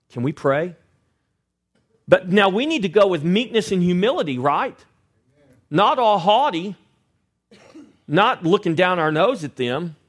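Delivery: full-force pulpit preaching; fiery preaching. Acted. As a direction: preach it hard to the congregation.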